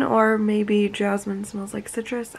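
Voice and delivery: normal talking voice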